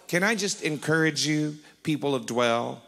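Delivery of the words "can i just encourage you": usual speaking voice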